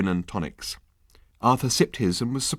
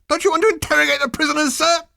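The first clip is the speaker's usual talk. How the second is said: much higher, louder, fast squeal; squealing underling; 6 dB louder, faster than usual. A narrator's reading. Number two pleads with the captain, a loud fast squeal. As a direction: squeal it like an overeager lackey. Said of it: "he squealed".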